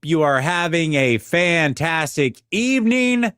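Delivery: high, punched-up show opener; big radio-host greeting, stretched at the end. Real, not acted. Host greets viewers at top of show, radio host voice. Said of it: "your radio host voice".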